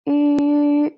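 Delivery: yu said high with rounded lips, u with two dots; pinyin yu. Shown as a demonstration. Teacher models the umlaut vowel for students, high and rounded. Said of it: "u with two dots".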